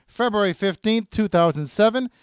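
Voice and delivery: upbeat promo announcer read, long drawn-out show title; radio ad read. Acted. Host promoting the soundtrack release, punchy announcer read. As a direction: sell the release like a radio spot.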